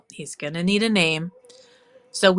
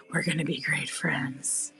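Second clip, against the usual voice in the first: whisper; 4 semitones lower, 7 dB quieter than usual. Pretend. Whispering to the gnome.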